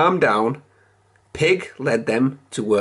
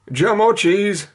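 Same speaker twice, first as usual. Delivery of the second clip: Kipper; 8 semitones higher than usual. A narrator's reading. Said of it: "said Kipper".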